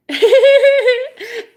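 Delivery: loud, high-pitched cackling laugh; high laughter. Real, not acted. Laughing at own awkward goodbye, high and loud.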